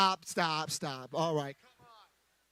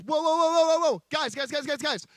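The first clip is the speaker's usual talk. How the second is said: high, loud call to the whole household; father shouting; 6 semitones higher, 5 dB louder than usual. Acted. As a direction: yell over the house to get everyone's attention. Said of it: "he screams to the household".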